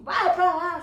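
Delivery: put-on loud jeering kid; mocking shout. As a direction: shout him away with disgust. Teasing kids push him away.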